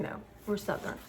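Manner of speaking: breathy near-whisper, stubbornly correcting; hushed insistence. Real, not acted. Vlogger rejecting the Mid-Atlantic label, hushed and insistent. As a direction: whisper it back like a private correction.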